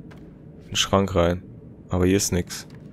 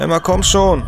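urgent urging; 10 semitones higher, 5 dB louder than usual. Real, not acted. Urging game character.

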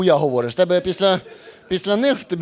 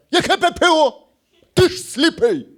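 much higher, louder outraged mother; mock shrill mom; 10 semitones higher, 4 dB louder than usual. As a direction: play the scandalised mom. Мама обурено відповідає сину. (обурена мама, висока й гучна)

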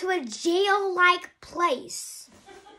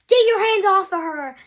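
loud, higher mock confrontation; angry retold order; 5 semitones higher, 7 dB louder than usual. Brooklyn telling Link to back off, angry and loud. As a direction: act out a protective telling-off.

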